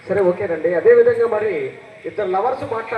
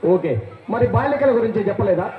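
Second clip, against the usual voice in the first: usual speaking voice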